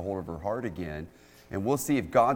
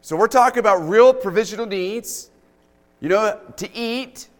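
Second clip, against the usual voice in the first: raised, fervent preaching voice, higher and louder; 10 semitones higher, 10 dB louder than usual. Acted. Pastor preaching to the church on big faith, fervent. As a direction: preach it with conviction to the congregation.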